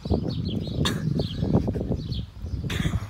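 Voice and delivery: breathy choking cough; cough from sour candy. Real, not acted. Sour candy makes him cough.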